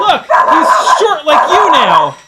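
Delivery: loud, high shout; yelling to a friend over the dog. Real, not acted.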